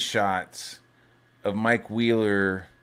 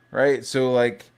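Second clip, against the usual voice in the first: ordinary talk, nothing marked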